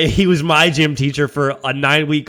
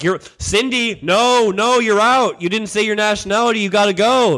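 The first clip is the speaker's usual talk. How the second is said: higher, lightly voiced teacher ruling a kid out; a hint of a fussy coach calling someone out; imitating the gym teacher; 6 semitones higher than usual. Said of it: "That's how he talked, too".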